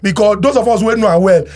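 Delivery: usual speaking voice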